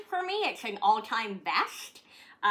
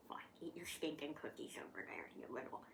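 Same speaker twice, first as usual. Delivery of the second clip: whisper; 6 semitones lower, 18 dB quieter, faster than usual. Real, not acted. Muttering at the dog.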